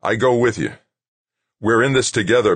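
determined, emphatic loyalty; grim, fierce. A narrator's reading. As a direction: vow it fiercely to a friend. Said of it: "Doug said grimly, fiercely".

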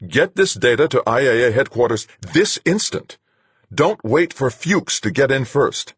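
high, loud, urgent orders; Duchamp, commanding. Acted. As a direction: order it done this instant.

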